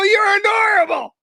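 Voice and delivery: high, loud gush of delight; a burst of delighted adoration. Retold or imitated. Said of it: "And I go".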